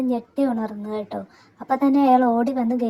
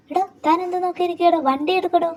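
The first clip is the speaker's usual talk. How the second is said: impatient scolding; 6 semitones higher, 3 dB quieter than usual. Acted. Mother scolds the driver.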